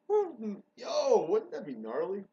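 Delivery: a giddy yelp at his own idea; excited. Real, not acted. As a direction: burst out with sudden excitement. Gets hyped by his own idea.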